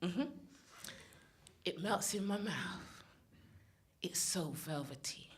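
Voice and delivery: hushed, very slow, with long pauses; mock sultry poetry reading. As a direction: savour each word in a low near-whisper. Performs a mock sensual poem.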